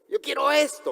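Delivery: high-pitched acted demand; mock stubborn insistence. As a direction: insist like a stubborn believer arguing with God. Believer stubbornly argues with God.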